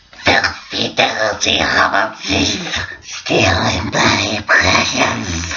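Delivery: slow, rasping creature voice; hammed-up wretched cave-creature impression; Smeagol. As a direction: rasp it out greedily, stretching the hissing sounds. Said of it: "they're gonna try and do Smeagol".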